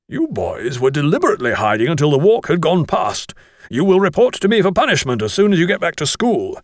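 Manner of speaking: forceful telling-off, rising in pitch; stern schoolmaster. Acted. Master accuses boys of hiding, stern scolding. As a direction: reprimand the boys with authority.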